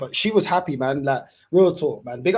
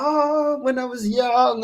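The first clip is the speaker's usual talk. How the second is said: nostalgic mum quote; 8 semitones higher, slower than usual. Retold or imitated. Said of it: "She's like".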